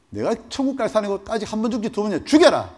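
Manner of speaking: loud, high bravado; defiant boast. Pretend. Pastor taunting captors about heaven, loud bravado. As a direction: throw it in their faces. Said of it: "제가".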